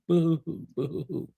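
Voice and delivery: mock boo-hoo sobbing. Mocking a chatter as crybaby.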